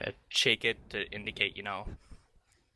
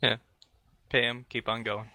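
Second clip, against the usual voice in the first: ordinary talk, nothing marked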